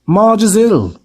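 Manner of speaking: gruff announcement to his wife; informing uncle. A narrator's reading. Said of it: "he informed Aunt Petunia".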